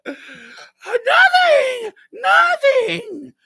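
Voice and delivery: hammed-up sobbing shout; crying. Crying boy sends stepdad away, hammed-up bawling. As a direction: wail it very high and loud through mock tears. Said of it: "And I just start crying".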